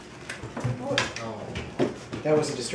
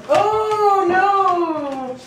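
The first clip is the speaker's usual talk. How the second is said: surprised; 7 semitones higher, 5 dB louder than usual. Real, not acted. Dog falls off.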